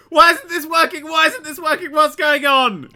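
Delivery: exasperated at broken code; frustrated. Retold or imitated. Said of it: "I'm there going".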